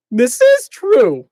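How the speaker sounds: high, very slow solemn voice; Benkei voice. Acted. Benkei confirming the news, slow and high. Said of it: "what was Benkei's voice?".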